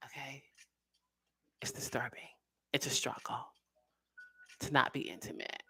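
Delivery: hushed whisper. Real, not acted. Reader hushes while reading the Temperance card, whispering. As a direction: drop to a breathy hush.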